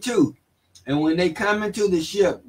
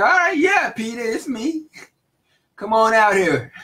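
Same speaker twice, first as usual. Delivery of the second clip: excited call; 5 semitones higher than usual. Acted. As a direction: call him out eagerly, voice jumping up. Jesus calls Peter, excited voice.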